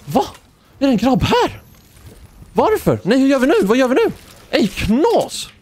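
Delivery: high, astonished exclamations; shocked disbelief. Real, not acted. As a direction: react like you can't believe your eyes. Shocked by strange enemies nearby. (hög, chockad förvåning)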